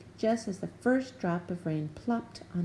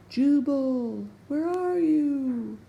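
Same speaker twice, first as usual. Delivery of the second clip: slow call from far off; faint distant call; 8 semitones higher, 6 dB louder, much slower than usual. A narrator's reading. Gertie calling for Jubal in the storm, slow distant call. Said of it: "a faint voice called in the distance".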